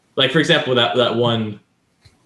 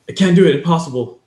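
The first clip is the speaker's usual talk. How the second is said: exasperated refusal; 7 semitones higher, 5 dB louder than usual. Retold or imitated. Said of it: "muri is like".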